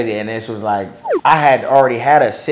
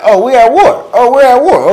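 mock war declaration; 11 semitones higher, 8 dB louder than usual. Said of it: "I was like".

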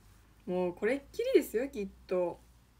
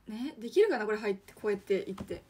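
ordinary talk, nothing marked

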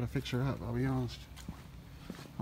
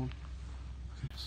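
whispering; 7 dB quieter, much slower than usual. Real, not acted. Quiet look around cold building.

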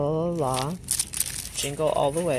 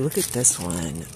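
normal talking voice

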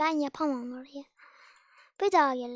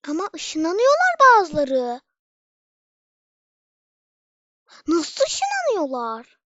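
frustrated yell; 2 semitones higher, 10 dB louder, slower than usual. Real, not acted. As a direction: shout in disbelief at the game. Frustrated gamer yelling at game. (sinirli bağırış)

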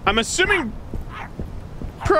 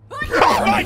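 startled shout. Real, not acted. Sudden enemy.

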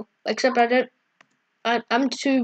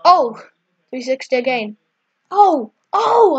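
surprised; 5 dB louder than usual. Real, not acted. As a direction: gasp at the clutch move. Gasps at a success.

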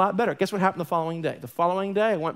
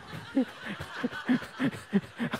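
soft unvoiced chuckle; breathy laugh; 6 semitones higher, 7 dB quieter than usual. Real, not acted. Pastor laughing at his joke about Tim leaving. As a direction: laugh under your breath.

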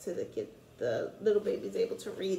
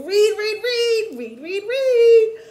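rising, much louder chant of read; excited urging; 12 semitones higher, 11 dB louder, slower than usual. Real, not acted. Teacher urging children to read this summer, excited loud chant. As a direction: cheer them on, each read higher and louder.

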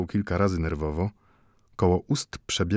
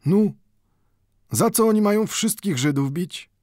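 put-on higher old man's voice asking as if casually; feigned indifference; 11 semitones higher, 4 dB louder than usual. A narrator's reading. Mendel asks the watchmaker with feigned indifference. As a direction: ask it as though it barely matters. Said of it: "niby obojętnie zapytał".

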